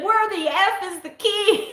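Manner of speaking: high-pitched mock outrage; retold frustrated wail; exasperated demand. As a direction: demand it in hammed-up frantic bafflement. Retold frantic demand to husband.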